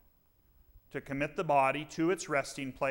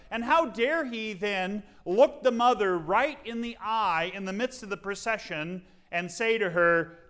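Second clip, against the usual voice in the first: normal talking voice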